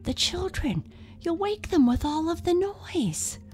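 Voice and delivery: high, worried little monster; high-pitched timid character voice; anxious warning. A narrator's reading. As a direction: fret that someone will hear. Timid monster warns noisy friend.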